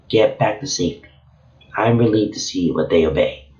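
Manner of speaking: usual speaking voice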